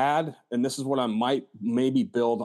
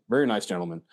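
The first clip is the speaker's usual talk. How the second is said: ordinary talk, nothing marked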